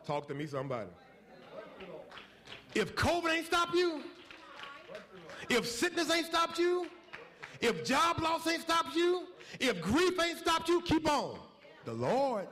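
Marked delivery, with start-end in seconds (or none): 2.8-11.4 rising exhortation. Real, not acted. Preacher exhorting congregation.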